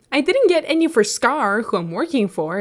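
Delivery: high, loud mocking voice; mocking Beat. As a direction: parody a bad liar's excuse. Continued mock of Beat.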